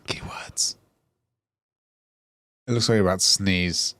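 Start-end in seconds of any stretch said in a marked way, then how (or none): 0.1-0.8 unvoiced whispered word; whispering. Real, not acted. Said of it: "You started whispering".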